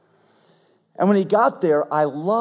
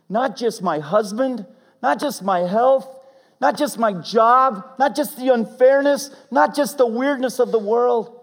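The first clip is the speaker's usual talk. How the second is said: rising higher and louder with each line; building preaching; 10 semitones higher, 7 dB louder than usual. Real, not acted. Preacher leading the congregation's surrender, building louder. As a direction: build the list to a climax.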